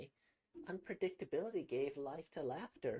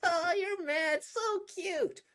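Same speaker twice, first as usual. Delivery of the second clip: laughing; 7 dB louder than usual. Acted. Girl laughing, teasing.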